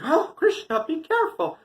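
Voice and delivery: high, loud worried warning; alarmed mother. Acted. Mother warns her toddler juggling hot coals, high alarm. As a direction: cry out a warning to a reckless toddler.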